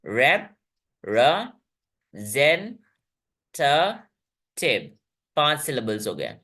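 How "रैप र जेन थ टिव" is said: रैप, र, जेन, थ, टिव as five detached beats; syllable by syllable. Shown as a demonstration. Teacher showing five syllables, रैप-र-जेन-थ-टिव separated. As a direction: pause after each part: रैप, र, जेन, थ, टिव. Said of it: "इसमें पांच syllables हैं".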